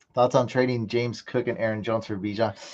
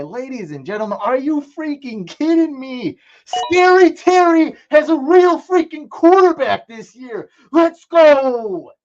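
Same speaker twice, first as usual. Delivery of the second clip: much higher and louder, announcer-style hype; hyped celebration; 13 semitones higher, 10 dB louder than usual. Real, not acted. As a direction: shout it like the crowd just went wild. Celebrating his draft pick, hyped.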